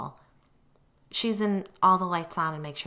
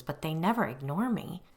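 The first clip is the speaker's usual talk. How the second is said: ordinary delivery, nothing marked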